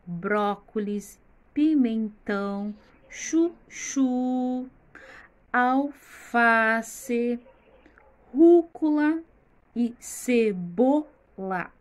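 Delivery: "Brócolis, pimentão, chuchu, alface, rúcula e cebola" slowly, stressed syllables punched; syllable-by-syllable pronunciation. Shown as a demonstration. Teacher pronouncing vegetables for children, slowly with strong syllables stressed. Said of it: "Pronuncie o nome dos legumes".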